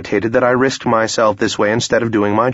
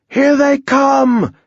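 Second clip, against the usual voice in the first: high, long-drawn yell; yelled warning; 3 dB louder, much slower than usual. Acted. Soldier warning the troops of incoming javelins, yelled. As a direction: yell it out slowly to the whole line. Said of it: "yelled Rasha".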